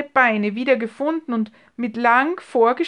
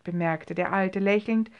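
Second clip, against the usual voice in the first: ordinary delivery, nothing marked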